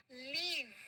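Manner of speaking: लीव with a long drawn-out ee; long-vowel leave. Shown as a demonstration. Teacher introducing a new word to class, लीव said with long ee. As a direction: hold the ee in लीव, as in "leev". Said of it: "इसको क्या बोलते हैं".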